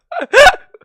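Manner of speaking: high, loud giggle burst; burst of laughter. Real, not acted. Streamer laughing at own bold line, high and loud. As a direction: crack up at yourself.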